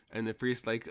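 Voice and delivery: ordinary delivery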